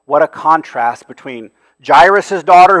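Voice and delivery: loud voiced "What a contrast"; emphatic. Shown as a demonstration. Preacher proclaiming the contrast to the congregation. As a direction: proclaim it fully.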